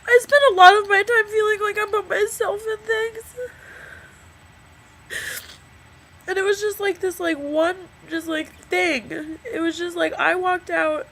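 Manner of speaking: real tears, voice high and breaking; crying. Real, not acted. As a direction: cry through the words. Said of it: "I'm crying into a video".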